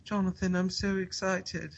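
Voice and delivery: put-on high, soft, sweet little British boy voice; British accent. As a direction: imitate a delighted Brit, softly and high-pitched. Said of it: "he's another British guy".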